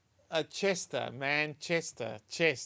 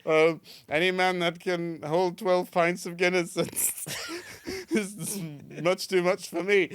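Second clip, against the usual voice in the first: mock wife; 4 semitones higher, 5 dB louder than usual. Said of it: "puts his".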